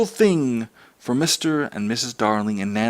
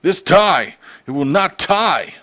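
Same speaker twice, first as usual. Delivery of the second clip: loud, higher exasperated yell; father yelling about his tie; 7 semitones higher, 5 dB louder, slower than usual. Acted. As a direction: shout it at the tie. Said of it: "He really yelled".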